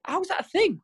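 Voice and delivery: mock-bewildered parent; incredulous. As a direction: act out disbelief at the sudden mood switch. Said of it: "You're like".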